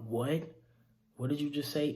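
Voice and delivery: loud and high, stunned disbelief; shocked outburst. Retold or imitated. Aunt bursting in on hearing the news, shocked shout. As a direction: blurt it out as the awful news lands. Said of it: "she busts through the door talking about".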